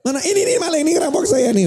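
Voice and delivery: high-pitched, hammed-up accusation; mock alarm cry. Debtor accusing the collector of robbery, high mock alarm. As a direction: cry out as if calling the neighbours. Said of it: "teriak saja".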